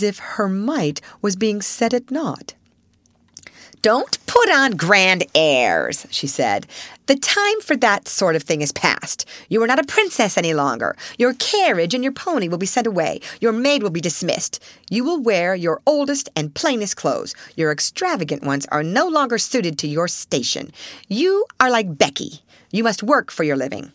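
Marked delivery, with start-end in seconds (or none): 3.8-6.1 slow sneer. Acted. Headmistress sneers at the child. 7.1-23.9 harsh headmistress. Acted. Headmistress strips her privileges.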